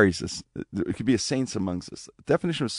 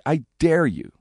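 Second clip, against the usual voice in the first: louder, higher angry challenge; angry dare; 7 semitones higher, 4 dB louder, slower than usual. Retold or imitated. Teller re-enacts challenging Saint Therese in church, angry. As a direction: throw the dare out angrily, leaning on DARE. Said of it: "I said it a lot more angry".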